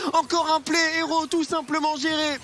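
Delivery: excited caster calling a steal; esports caster. Acted. Hyped play-by-play casting.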